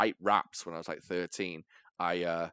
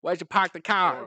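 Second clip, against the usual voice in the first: raised-pitch Boston caricature; mock Boston accent; 7 semitones higher, 4 dB louder than usual. Offering another American type, mock Boston accent. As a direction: put on a Boston accent, playfully.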